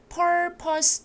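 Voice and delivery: "purpose", both vowels a relaxed, narrow 'uh'; narrow 'er' vowel. Shown as a demonstration. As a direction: say "purpose" with an 'er' made without opening the mouth wide. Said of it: "입을 크게 벌리시는 어는 아닙니다".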